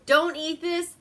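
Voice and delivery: imitated alarm, high and loud; mock urgent warning. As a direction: call it out like warning a crowd. Imitates him warning everybody.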